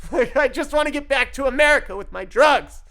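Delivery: loud, high-pitched pleading yell. Retold or imitated. Yelling at the voices from the border line, loud exasperated protest. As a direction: shout it out in exasperation. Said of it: "So I'm yelling at the voices like".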